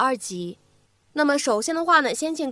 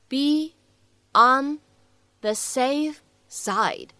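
each word set apart with pauses; slow word by word; much slower than usual. Shown as a demonstration. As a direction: spell it out slowly, one word at a time. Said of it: "我们需要注意哪些发音技巧呢".

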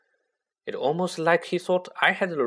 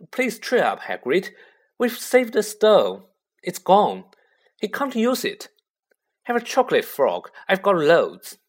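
coaxing cheer; 7 semitones higher, 6 dB louder than usual. A narrator's reading. Harry cheers up Hagrid.

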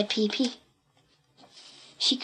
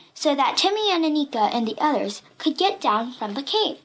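ordinary delivery

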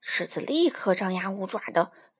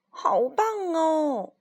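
very slow, drawn-out, higher and louder cry of delight; delighted cheer; 5 semitones higher, 3 dB louder, much slower than usual. Acted. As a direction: stretch it out like a thrilled child in the audience. Crowd cheering the dancing lion.

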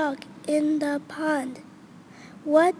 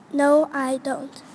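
normal talking voice

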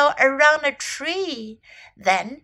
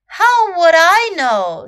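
loud, slow, higher character voice; put-upon turtle; 5 semitones higher, 9 dB louder, slower than usual. A narrator's reading. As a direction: rebuff the question, drawn out and loud. Turtle rebuffs the questioner.

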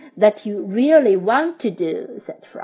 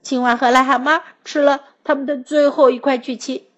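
ordinary delivery, nothing marked

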